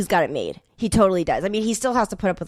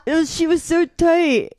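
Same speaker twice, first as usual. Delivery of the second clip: slow giggling excuse, voice pitched up; laughing through a quote; 9 semitones higher, slower than usual. Retold or imitated. Actor laughing out his excuse to the director. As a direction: laugh out the flustered excuse slowly. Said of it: "And the guy's like".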